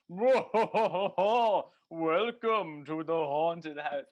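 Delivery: mock spooky announcer, stretched slow; spooky door knocker voice. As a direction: ham up a cheesy ghost-house welcome. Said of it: "and it goes".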